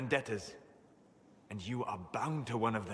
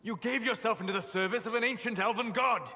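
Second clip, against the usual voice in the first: angry rebuke; 9 semitones higher, 3 dB louder than usual. Acted. Rebukes friend for drinking from well.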